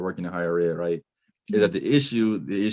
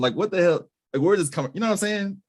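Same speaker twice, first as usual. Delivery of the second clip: incredulous; 12 semitones higher than usual. Real, not acted. Baffled by education jargon.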